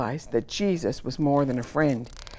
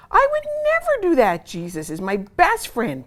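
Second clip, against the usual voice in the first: indignant; 11 semitones higher, 5 dB louder than usual. Acted. Peter's indignant thought.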